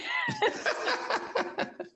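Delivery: real laugh that shoots up high then falls away; high burst of laughter. Real, not acted. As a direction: break into a high delighted laugh. Host laughs at joke.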